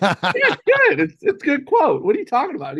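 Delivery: mock surprise at his father's question; incredulous excitement. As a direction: gush about the quote in disbelief. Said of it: "I'm like".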